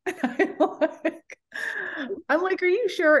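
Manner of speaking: can barely get it out for laughing; high-pitched laughing question. Real, not acted. Laughing through retold question.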